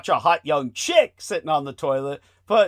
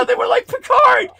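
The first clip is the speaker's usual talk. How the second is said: loud, much higher burst; excited shout; 8 dB louder than usual. Real, not acted. Friends recalling the Montauk chair story, excited shout. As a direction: blurt it out with glee. Excited about the Picard scene.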